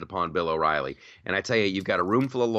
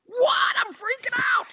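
very high, hammed-up panicked shriek; mock freak-out; 3 dB louder than usual. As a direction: scream it in pretend hysteria. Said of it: "I'm gonna freak out".

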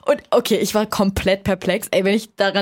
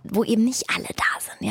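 whisper; 5 dB quieter than usual. Real, not acted. Geheimer Ort, verschwörerisch geflüstert.

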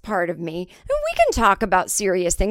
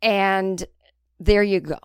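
usual speaking voice

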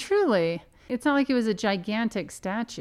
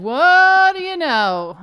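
high, loud and drawn out; gloating; 9 semitones higher, 10 dB louder, slower than usual. Real, not acted. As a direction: crow it triumphantly. Gloating about being right.

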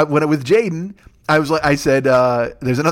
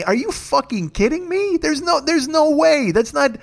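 high-pitched, slowing disbelief; petulant, high protest against a Skype offer; 11 semitones higher, slower than usual. Retold or imitated. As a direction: whine it like a put-upon kid. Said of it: "here's why I'm being so petulant".

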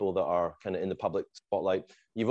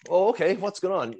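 mock flustered, pitched well up; 9 semitones higher than usual. Rock star approached by a stranger in the office, mildly flustered. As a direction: act out being thrown a little, voice lifted high. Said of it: "a little flustered".

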